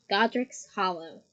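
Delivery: ordinary delivery, nothing marked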